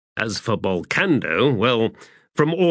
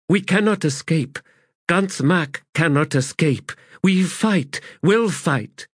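halting foreign woman, higher and careful; 6 semitones higher than usual. A narrator's reading. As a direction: pick each trade-tongue word carefully. Calith speaks broken trade tongue.

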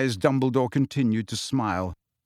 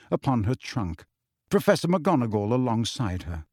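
ordinary delivery

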